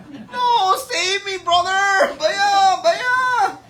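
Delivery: put-on very high, loud child's wail; hammed-up wailing plea. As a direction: cry out desperately for rescue. Krishna begs brother to save him.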